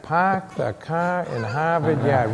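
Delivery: put-on slow, puzzled Boston accent, wondering aloud; slow puzzled repeat. A sixth grader puzzled by the teacher's line, slow Boston accent. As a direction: repeat it slowly in a Boston accent, not getting the joke. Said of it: "I can remember sitting there going".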